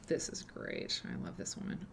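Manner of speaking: hushed whispered aside; whispering. Real, not acted. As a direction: whisper it to yourself. Aside while reading a book.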